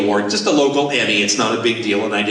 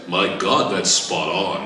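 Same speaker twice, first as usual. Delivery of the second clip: put-on old man; 6 semitones lower, slower than usual. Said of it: "Gary Owens looks at me and goes".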